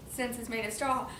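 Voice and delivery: usual speaking voice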